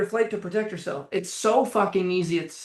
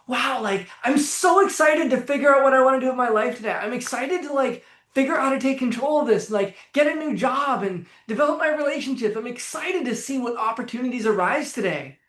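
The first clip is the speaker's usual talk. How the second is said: higher-pitched, gushing eagerness; mock excited; 4 semitones higher than usual. Anxious person's imagined upbeat self-talk, mock excited. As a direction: play up excitement about the day ahead. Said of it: "is that the tone of voice".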